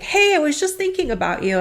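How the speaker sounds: retold eager greeting. Boss greets her as she walks in, eager. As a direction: imitate a boss's eager hello.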